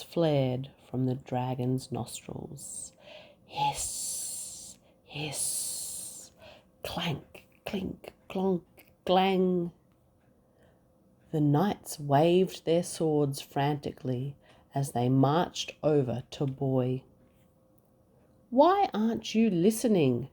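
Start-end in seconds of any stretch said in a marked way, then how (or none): 3.5-8.6 whispered hiss and soft clinks; hissing flames and clanks. Acted. Narrator voicing dragon fire and armour. 18.5-20.3 higher, louder, exasperated question; a touch of a king yelling; mild shout. Acted. King shouting at Boy why he isn't listening. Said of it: "shouted the king".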